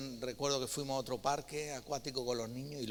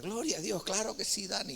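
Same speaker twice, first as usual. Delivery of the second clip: excited churchgoers; 7 semitones higher than usual. Retold or imitated. Churchgoers cheering the pastor's plan.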